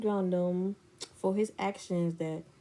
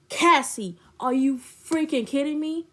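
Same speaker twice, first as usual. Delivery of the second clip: louder, higher retold outrage; pissed off; 5 semitones higher, 4 dB louder than usual. She confronts friend over feelings, retold anger. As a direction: re-enact an incredulous telling-off. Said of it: "I was so freaking pissed".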